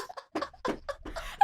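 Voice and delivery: breathless laugh. Real, not acted. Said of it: "I think my voice cracked".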